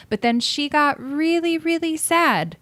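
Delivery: overdone storybook sadness; mock sad. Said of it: "Cue tears rolling down her face".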